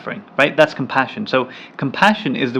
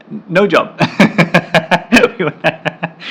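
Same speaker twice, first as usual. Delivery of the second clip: amused, higher voice bubbling into laughter; laughing; 5 semitones higher, 4 dB louder than usual. Real, not acted. Teacher joking to viewers about preferring no job, laughing. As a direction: break into a real laugh on the words.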